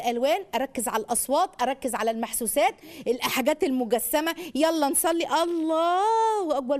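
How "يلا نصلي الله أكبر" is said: put-on calling child to prayer. Shown as a demonstration. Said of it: "ما أقول كده".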